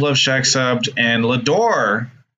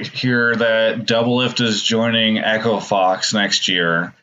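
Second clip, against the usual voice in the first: usual speaking voice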